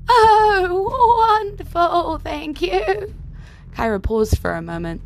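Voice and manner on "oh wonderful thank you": put-on much higher, bright young woman; high girl voice. Kyra answers the horse.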